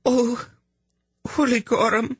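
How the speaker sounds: put-on high, crying young woman; sobbing. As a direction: sob the words out. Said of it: "she sobbed".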